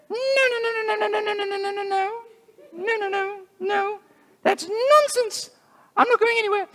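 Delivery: very high squeaky string of no's; stubborn bunny. Acted. Sickle the bunny refusing to leave, squeaky and very high. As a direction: refuse flatly like a cartoon bunny. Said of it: "And Sickle said".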